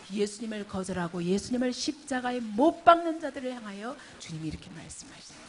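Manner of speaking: ordinary delivery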